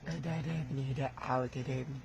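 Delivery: soft, gentle calming of a son. Real, not acted. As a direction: hush him down tenderly. Elder calming the young man. (تهدئة بصوت خافت حنون)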